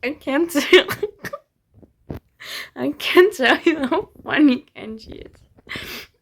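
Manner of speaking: talking through giggles, words drawn out; too tickled to finish the sentence; cracking up. Real, not acted. Cracking up over a funny scene.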